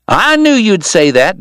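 high, slow joyous call; joyous holler. A narrator's reading. Doc calling back to Wyatt, joyous holler. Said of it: "Doc hollered back joyously".

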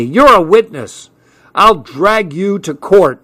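high, emphatic accusation; outraged threat. A narrator's reading. Man pointing at the child witness, outraged threat.

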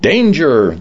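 lifeguard raising a narrated alarm; shouted alarm. A narrator's reading. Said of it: "the lifeguard shouted".

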